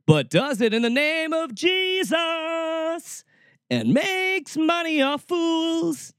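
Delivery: high, drawn-out ranting cry; slow, scornful wail; mocking outrage. Real, not acted. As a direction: stretch it out like a mock revival shout. Host mocks televangelist's money-making.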